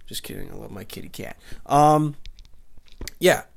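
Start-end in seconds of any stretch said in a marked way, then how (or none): 0.1-1.4 much softer, loving coo to the cat; whispered apology to his pet; tender to cat. Real, not acted.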